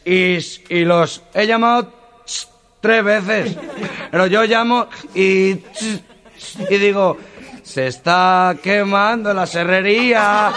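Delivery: desperate stutterer explaining his call; tartamudo. Acted. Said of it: "El tartamudo". (tartamudo desesperado, agudo y fuerte)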